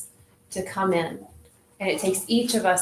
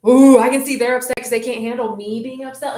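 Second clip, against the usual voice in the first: usual speaking voice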